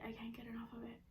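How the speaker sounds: breathy whisper from the spice heat; hushed, barely voiced. Real, not acted. Eating painfully spicy food.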